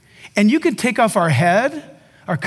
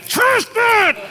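insistent coaxing; 12 semitones higher than usual. Retold or imitated. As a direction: push the spoon one last time. Coaxing spoon feeding climax.

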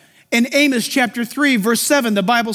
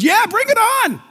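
much higher, cheerful bring-it-on; eager believer; 9 semitones higher than usual. Retold or imitated.

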